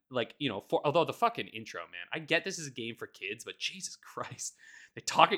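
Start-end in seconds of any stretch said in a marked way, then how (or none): 3.5-4.6 whispered, slow and softer; exasperated whisper. Real, not acted. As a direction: breathe it out in disbelief. Exasperated aside.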